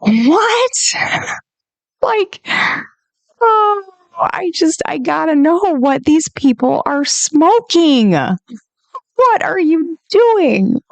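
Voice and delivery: high, stunned disbelief; exasperated what-are-you-doing; high-pitched outraged disbelief; incredulous. Real, not acted. Disbelief at fact check.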